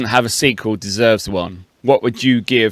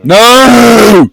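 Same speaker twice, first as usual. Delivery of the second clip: high, loud, long-held no; shouted refusal; 10 semitones higher, 11 dB louder than usual. Acted. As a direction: shout it over the other person. Shouts down the other's idea.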